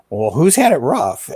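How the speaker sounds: retold disbelief. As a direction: toss back a mildly astonished challenge. Retold disbelieving reply to his dad.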